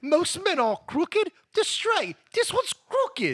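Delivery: high, slow mock English accent. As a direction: explain it like a prim Englishman. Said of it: "Things are different in England".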